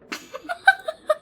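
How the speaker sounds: shrill laugh bursting out; high-pitched cackle. Real, not acted. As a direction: cackle at your own joke. Laughing at own insult.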